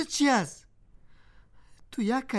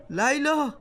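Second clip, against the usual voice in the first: calling out; 6 semitones higher, 4 dB louder, much slower than usual. Acted. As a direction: shout her name across the room. Husband calls out to wife. (صدا زدن بلند)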